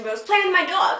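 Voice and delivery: ordinary talk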